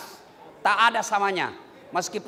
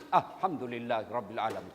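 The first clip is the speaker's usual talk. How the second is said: grateful prayer; 13 semitones lower, 8 dB quieter than usual. Shown as a demonstration. Grateful prayer on hearing news.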